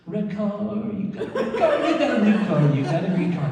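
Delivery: high-pitched, giddy stoned babble; stoned car-spotting. Retold or imitated. Narrator stoned at a window naming cars, high giddy voice. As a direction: stretch the words out in a high, dopey voice.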